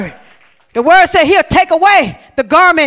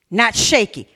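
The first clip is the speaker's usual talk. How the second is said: usual speaking voice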